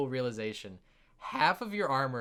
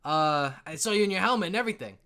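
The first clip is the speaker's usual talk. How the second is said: raised, louder voice, eager to report; perky dwarf; 6 semitones higher, 5 dB louder than usual. Acted. Dwarf perks up about the missing armor, eager. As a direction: pipe up helpfully as a tavern dwarf.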